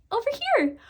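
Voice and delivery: put-on tiny high baby voice. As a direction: pipe it up like a little child. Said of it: "in a little baby voice again".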